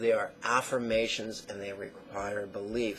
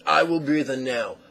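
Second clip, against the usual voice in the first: firm, louder commands to your own breath; bossy, louder self-instruction; controlling voice; 8 dB louder than usual. Retold or imitated. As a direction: dictate each breath like a drill sergeant. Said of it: "give into those tendencies and say".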